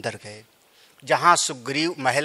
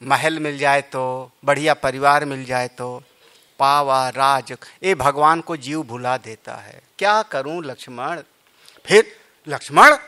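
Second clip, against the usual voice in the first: ordinary talk, nothing marked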